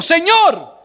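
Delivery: high, fearful cry of Señor; a hint of a frightened Saul; trembling and fearful. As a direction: call out to the Lord, trembling. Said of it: "él temblando y temeroso".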